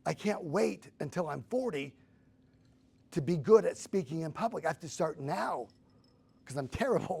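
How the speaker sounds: rising excitement at a discovery; excited realization. Retold or imitated. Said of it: "And I'm like".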